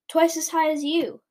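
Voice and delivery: mildly astonished cry. A narrator's reading. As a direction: exclaim it up high, a touch amazed. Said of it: "cried Sophie".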